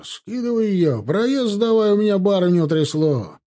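high, indignant coachman's yell. A narrator's reading. Coachman demanding passage for his mistress, yelling. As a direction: holler for the road to be cleared. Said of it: "орал с козел кареты".

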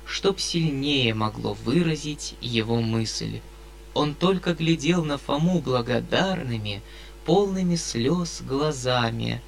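ordinary delivery, nothing marked

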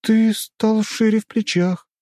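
high, slow female character voice; high-pitched, slowed wonder; awed heroine. A narrator's reading. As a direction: take him in slowly, in a high voice. Heroine addressing the revived man.

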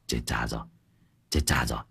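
low, soft panicked gasp; panicked whisper. A narrator's reading. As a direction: hiss it in sudden panic. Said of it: "Con mắt trợn tròn".

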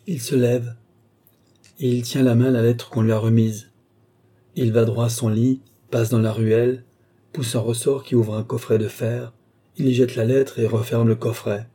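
ordinary delivery, nothing marked

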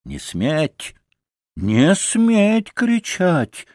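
rising to a high, drawn-out cry; commanding cry. A narrator's reading. Grushenka forbids the Poles to shout, high commanding cry. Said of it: "крикнула Грушенька".

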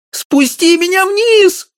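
very high, slow squeal; squeaking wife. A narrator's reading. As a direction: squeal from the top of the pedestal. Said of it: "пищала она". (жена пищит очень высоко)

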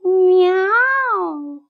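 high, long meow; drawn-out cat meow. A narrator's reading. Hidden someone disturbs the puppy lying down, long high meow. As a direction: imitate a cat, stretching the meow. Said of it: "И тут кто-то опять сказал".

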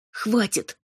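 ordinary delivery, nothing marked

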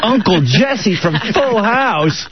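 high, drawn-out mock amazement; thrilled-kid impression, stretched slowly in disbelief; imitating starstruck kids. Dad mimicking kids hearing who joins dinner, starstruck and high. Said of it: "out of their friggin' minds".

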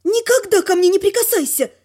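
high, indignant warning; voiced outburst of anger; angry hissing. A narrator's reading. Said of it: "зашипела я на него".

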